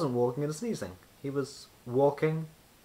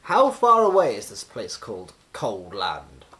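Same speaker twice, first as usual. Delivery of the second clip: wizard voice; 3 semitones lower, 8 dB louder than usual. A narrator's reading. Wizard asks.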